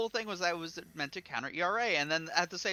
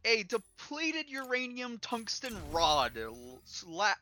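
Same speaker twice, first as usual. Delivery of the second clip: higher, emphatic argumentative rant; exasperated retort; 5 semitones higher than usual. Retold or imitated. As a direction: argue back in heated disbelief. Retold heated argument about tank armor.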